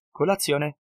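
Jacob's cheery offer. A narrator's reading. Jacob waving salted meat at Kurt, grinning offer. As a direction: push the meat at him with a grin.

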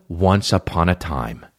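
low, slow fairy-tale narrator; storybook opening. Acted. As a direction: intone it like the start of a tale. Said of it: "compliments don't start with".

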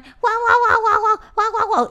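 high yappy barks imitating a dog; little dog barking for attention. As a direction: yap like a needy little dog. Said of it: "Como cuando los perros están".

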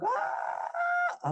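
very high, drawn-out yell; imitated piercing cry of pain; child's scream. Boy with hand caught in door, long high scream. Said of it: "פתאום מתחיל לצעוק".

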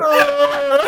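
high, hammed-up fake moaning grunt; mock moan. Shown as a demonstration. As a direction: groan it out like a cow in labour. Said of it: "You know how you moan".

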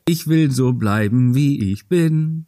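slow, drawn-out ad line; ad jingle line. Retold or imitated. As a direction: deliver it like an old TV ad. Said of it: "diese bescheuerte Werbung".

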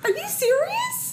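very high, rising astonished question; incredulous. Real, not acted. Host checking the guest's story, incredulous. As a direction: squeal it in real disbelief.

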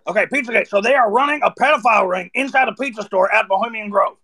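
an over-the-top Alex Jones rant. Pretend. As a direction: rant like an InfoWars host. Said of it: "see what that one's all about".

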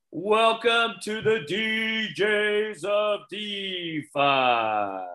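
loud, hyped show opener; excited host shout. Real, not acted. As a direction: announce it big and slow. Opening the live show.

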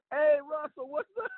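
high, hollered hello; mock high-pitched cheery greeting; backup officer's greeting. Backup officer greets colleague, acted.

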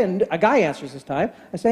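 ordinary delivery

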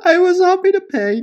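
high, loud read fresh from laughing; amused outburst. Real, not acted. Reading a gym complaint after cracking up, high and amused. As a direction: read it out loudly, just recovered from a laughing fit.

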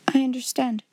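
ordinary delivery

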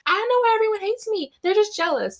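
put-on high, fast mean-girl whine. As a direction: mimic the snooty popular girl playing innocent. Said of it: "the meanest girl in your high school would always say".

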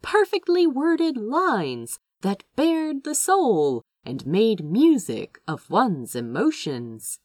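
plain reading voice

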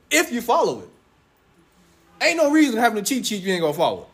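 ordinary talk, nothing marked

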